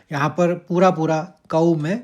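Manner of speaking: normal talking voice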